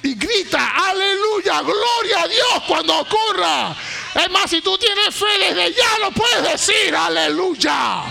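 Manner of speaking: high, loud, declaring prophetic preaching; fiery church exhortation; full-force preaching. Real, not acted. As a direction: proclaim it over the congregation at full voice.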